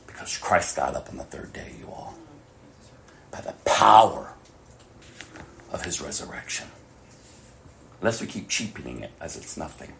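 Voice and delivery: ordinary delivery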